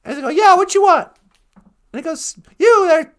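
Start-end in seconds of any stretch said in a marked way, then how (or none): 0.3-1.1 put-on high, loud street-kid reply; shouting back. Boy answers Scrooge from the street, shouting back. 2.6-3.1 high, loud hail; calling out. Acted. Scrooge hails the child below, calling out.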